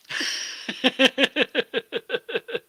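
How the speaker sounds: high rapid giggle; real amused laughter. Real, not acted. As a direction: burst into a long, high chuckle. Amused at co-host's camera joke.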